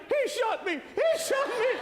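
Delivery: high, shouted, slowing on the repeat; panicked shout. Retold or imitated. Shot goalie runs off, shouting in high panic. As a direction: yell it like a goalie running scared. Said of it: "he started shouting".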